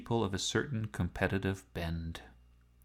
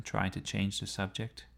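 plain reading voice

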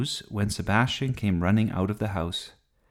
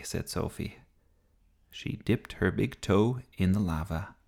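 plain reading voice

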